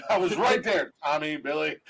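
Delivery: normal talking voice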